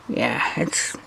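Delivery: breathy whisper to herself. Real, not acted. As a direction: whisper it under your breath. Muttered check on the measurement.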